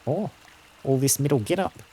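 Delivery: hammed-up New Zealand accent; mock Kiwi accent. Player mimicking a Kiwi about metal get-up, mock New Zealand accent. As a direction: put on a rough Kiwi accent. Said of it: "My apologies for the insulting accent".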